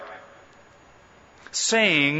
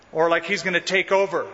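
ordinary talk

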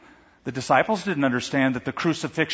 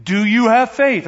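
booming God's question; 7 semitones higher, 6 dB louder than usual. Acted. Heavenly voice questions man.

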